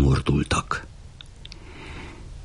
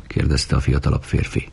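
plain reading voice

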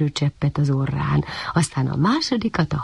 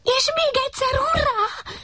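cheering. A narrator's reading. Puppy cheers water again.

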